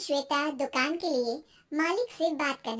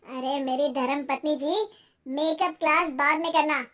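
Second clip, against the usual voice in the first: pleased husband; 6 dB louder than usual. Acted. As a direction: announce good news as the husband.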